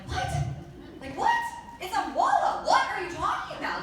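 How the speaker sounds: high, loud incredulous outburst; acted disbelief. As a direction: replay a shocked double-take, pitch shooting up. Retells disbelief at sales news.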